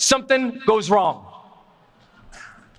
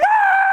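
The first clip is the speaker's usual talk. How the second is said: very high, sustained mock shriek; helpless comic falling scream; 4 dB louder than usual. Panicked skydiver unable to follow the guide, screaming. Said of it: "But I'm screaming".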